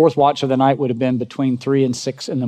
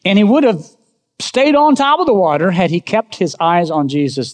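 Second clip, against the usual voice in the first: preaching fire; 7 semitones higher, 4 dB louder than usual. Acted. Preaching defence of Peter.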